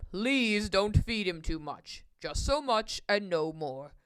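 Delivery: put-on slow, low older man; deep, deliberate admonishment; Mister Carp cautioning. A narrator's reading. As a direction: warn him slowly in a deep voice.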